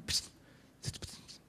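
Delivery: unvoiced hissing psst sounds; whispered psst. Preacher mimics hurtful words leaking, whispered psst. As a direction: hiss it out like a leak.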